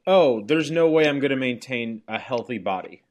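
usual speaking voice

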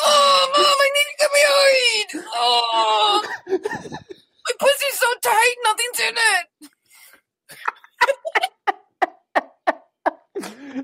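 put-on high wailing teen cry; whiny teenager voice. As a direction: wail at Mom like a teen. Riffs on the teen bit.

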